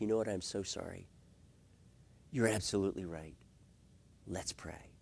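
soft, low, gentle concession; humble apology. Acted. Husband yielding to his wife when she attacks, humbly apologetic. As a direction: back down gently and sincerely.

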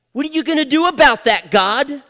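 loud, high-pitched angry challenge; upset confrontation. Retold or imitated. Father challenging God in his study, upset and loud. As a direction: have it out with God at the top of your voice. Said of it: "I was upset".

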